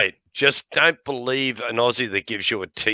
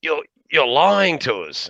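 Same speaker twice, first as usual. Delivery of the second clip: suspicious tourists; 6 semitones higher, 3 dB louder, slower than usual. Retold or imitated. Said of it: "And they're going".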